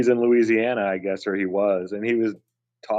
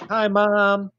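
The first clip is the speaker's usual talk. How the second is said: cheery camera wave; 10 semitones higher, 3 dB louder than usual. Retold or imitated. Mimicking eighties camera wavers.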